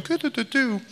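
high cooing nonsense; mock coochy-coo; baby talk. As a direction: trill light baby babble in a high voice. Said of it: "or going".

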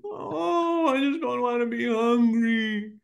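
put-on young daughter whining to daddy before dinner, high and tearful; whiny little girl. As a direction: whine like a hungry young daughter. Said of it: "not giving in to the whining".

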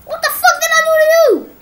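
loud, high indignant yell; real outrage after being hit; outraged scream. Real, not acted. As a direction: scream it at the attacker.

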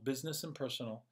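quick, quiet echo; soft fast echo. Shown as a demonstration. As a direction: let it fade as an echo. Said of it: "said twice, second fading".

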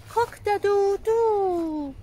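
high, slow crowing imitation; rooster crow. Rooster sound for the kids.

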